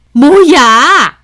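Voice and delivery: loud, high, drawn-out outburst; alarmed, angry demand. Acted. Mother-in-law rushes in at broken cup, loud angry demand. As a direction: burst in demanding an answer, loud.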